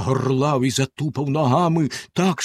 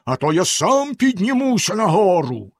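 higher threatening bellow; 7 semitones higher than usual. A narrator's reading. Bluebeard threatens to come upstairs, bellowing. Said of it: "знову заволав Синя Борода".

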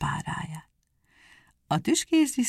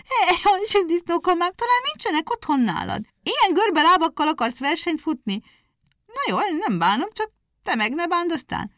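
mocking hare; 11 semitones higher, 4 dB louder than usual.

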